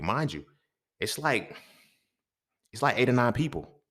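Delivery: usual speaking voice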